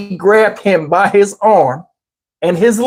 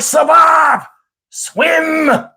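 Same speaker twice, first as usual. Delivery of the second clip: drawn-out, higher mock shout; mock yell; 8 semitones higher, much slower than usual. Granddad yelling to the son he threw in, mock shout. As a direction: call it out high, long vowels.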